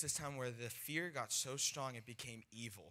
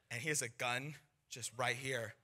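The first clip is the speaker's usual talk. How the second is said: normal talking voice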